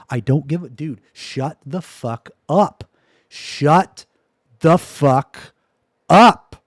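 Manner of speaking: drawn-out furious shout, last word loudest; angry yell. Real, not acted. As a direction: spit each word out at chat. Streamer yelling at chat about politics.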